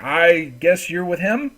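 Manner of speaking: drawn-out doubtful question to the newcomer; NPC sizing them up. Acted. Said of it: "He's like".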